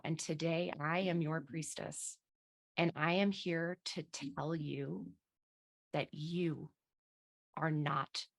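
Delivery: guided absolution. Acted. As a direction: hush it down like a priestess granting forgiveness. Priestess absolving, guided affirmation.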